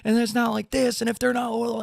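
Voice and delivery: high sneering mock-punk voice; high-pitched mocking voice of gatekeeping punks. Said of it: "the punks these days are like".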